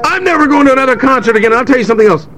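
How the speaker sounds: high, rapid imitated rant; ranting wife. Wife back home swearing off concerts, high mock rant. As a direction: rattle it off like a fed-up wife. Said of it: "my wife's like".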